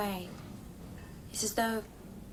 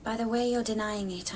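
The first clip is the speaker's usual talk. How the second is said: normal talking voice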